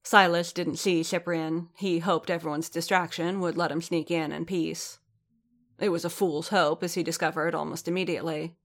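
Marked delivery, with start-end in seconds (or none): none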